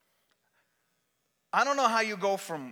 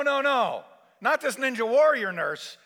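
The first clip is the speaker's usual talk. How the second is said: ordinary talk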